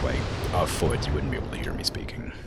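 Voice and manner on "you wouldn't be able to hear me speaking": whispering. Shown as a demonstration. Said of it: "if I leaned back".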